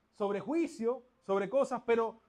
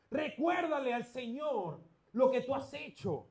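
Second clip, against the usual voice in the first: tearful plea; 4 semitones higher, 6 dB louder, slower than usual. Acted. Voicing the king's tearful plea.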